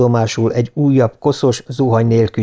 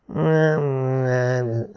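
soft half-asleep groan; 6 dB quieter than usual. A narrator's reading. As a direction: groan a refusal, softly. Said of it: "nyögte Johansen". (halkan nyögve)

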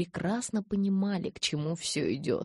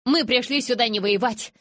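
raised, high, insistent voice; forceful protest; 8 semitones higher, 5 dB louder than usual. A narrator's reading. Witness to the hostile elders, forceful protest.

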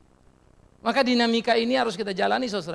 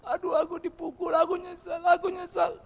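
higher, crying out, mock regret; mock remorseful cry; 9 semitones higher than usual. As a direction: whine like someone sorry after a beating. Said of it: "imitating remorseful person". (meniru orang menyesal, berseru tinggi)

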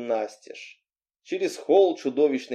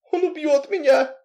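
high-pitched, mildly hopeless; doomed; 14 semitones higher, 3 dB louder than usual. A narrator's reading. Heroine telling Anton of her husband, doomed. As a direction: say it as a lost cause. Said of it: "сказала я обречённо".

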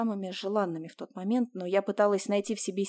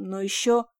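plain reading voice

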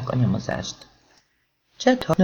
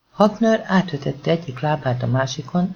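ordinary delivery, nothing marked

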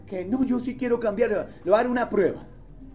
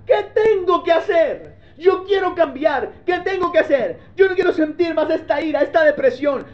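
desperate seeker; 7 semitones higher, 3 dB louder than usual. Retold or imitated. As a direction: beg God to show what to do. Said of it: "donde pregunta".

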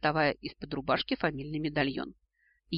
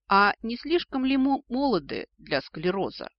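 mocking echo; 6 semitones higher, 4 dB louder than usual. A narrator's reading. Heroine turns the dragon's words on him, mocking. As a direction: parrot the reproach back mockingly. Heroine throws his phrase back.

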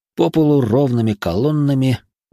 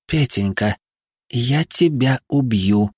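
menacing whisper; 4 semitones higher, much slower than usual. A narrator's reading. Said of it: "прошептала Лариса".

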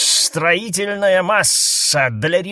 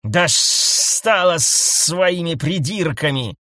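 slow fed-up outburst; fed-up exclamation; 3 semitones higher, 3 dB louder, much slower than usual. A narrator's reading. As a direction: vent it at last. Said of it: "воскликнул крулянец". (раздражённый возглас)

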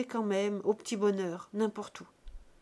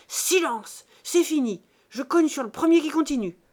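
much louder and higher, commanding threats; furious yell; 6 semitones higher, 10 dB louder than usual. A narrator's reading. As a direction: slam down the order on everyone. Buteau bangs the table, yelling. (hurlement menaçant)

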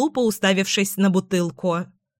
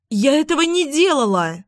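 higher-pitched protesting cry; 6 semitones higher, 3 dB louder than usual. A narrator's reading. Daughter denies drinking to her mother, crying out. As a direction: cry out the denial, voice raised high. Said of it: "закричала я".

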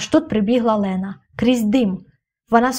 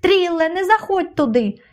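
high-pitched urgent call, moderate volume; storyteller's high contained shout; warning cry; 8 semitones higher than usual. A narrator's reading. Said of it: "крикнула мені".

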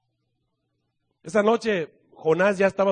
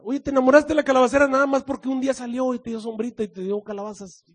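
normal talking voice

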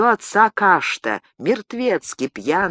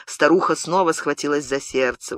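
ordinary delivery

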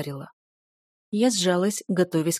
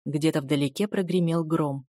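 ordinary delivery

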